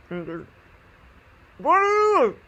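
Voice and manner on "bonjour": high, loud, garbled mask voice; imitating a masked man's muffled holler; muffled through a mask. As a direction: shout an unintelligible word into a mask. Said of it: "So this is his mask".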